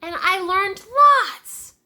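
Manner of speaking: a lightly voiced excited child; proud little boy. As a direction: kid bragging about school. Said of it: "he announced".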